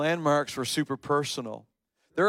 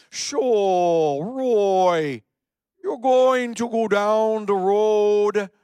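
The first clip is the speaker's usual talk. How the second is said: loud, drawn-out mock German accent, higher than usual; 5 semitones higher, 6 dB louder, much slower than usual. Boss giving directions to his work crew, heavy German accent. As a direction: imitate a heavy German accent, stretching the words. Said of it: "his German accent was fairly heavy".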